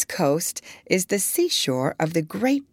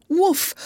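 higher, barking woof; higher yelping woof; dog bark; 11 semitones higher than usual. A narrator's reading. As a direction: bark once in the dream. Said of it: "he barked loud".

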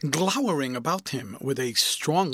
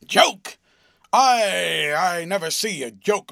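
loud, higher indignant snap at "Joke"; frowning retort; 4 semitones higher, 7 dB louder, slower than usual. A narrator's reading. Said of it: "frowningly retorted".

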